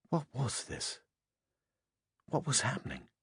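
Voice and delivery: hushed, high-pitched bewilderment; shocked thought, barely voiced; alarmed disbelief. A narrator's reading. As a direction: ask it very softly, in rising panic. Rosalind's panicked thoughts at the shocking picture.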